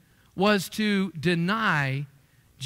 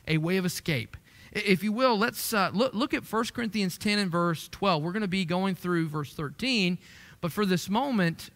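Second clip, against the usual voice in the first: usual speaking voice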